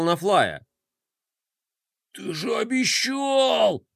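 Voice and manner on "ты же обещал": high, slow, pained cry; anguished groan. A narrator's reading. General reproaches Flay, groaning in shame. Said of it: "простонал генерал".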